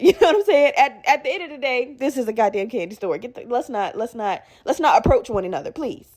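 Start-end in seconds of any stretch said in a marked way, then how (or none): none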